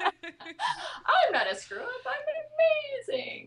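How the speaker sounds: high, slow, laughing through the words; squealing playful self-praise with a laugh; laughing mock affirmation. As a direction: proclaim it like a giddy pep rally.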